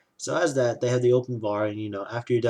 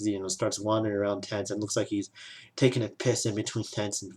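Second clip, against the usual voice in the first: ordinary delivery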